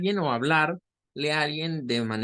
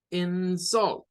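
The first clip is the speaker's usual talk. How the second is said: "insult" as the verb, in-SULT; higher-pitched demo of "insult", second syllable stressed; 4 semitones higher than usual. Shown as a demonstration. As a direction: put the force on "-sult" in "insult". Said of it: "la sílaba tónica es la segunda".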